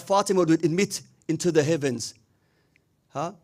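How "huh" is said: usual speaking voice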